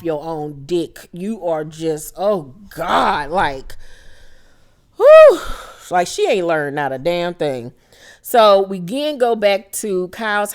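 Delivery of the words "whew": a sudden high, loud "whew" blown out; exasperated whew. Real, not acted. Recapper reacting to the cast member, loud high whew. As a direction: let out a sharp, loud exhale of disbelief.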